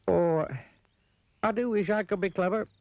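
very high, wistful figure voice; sighed wish. A narrator's reading. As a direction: sigh out a longing wish up high. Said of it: "he sighed".

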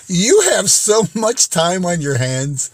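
ordinary talk

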